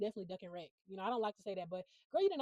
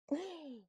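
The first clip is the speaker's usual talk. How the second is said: gasp; 8 semitones higher than usual. Real, not acted. Shocked by John's comment.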